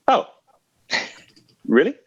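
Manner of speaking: high doubtful "oh really"; imitating a suspicious casino guy; mock skeptical. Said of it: "he was just like".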